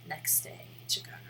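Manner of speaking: unvoiced murmur while typing; whispered. Real, not acted. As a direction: whisper it to yourself as you type.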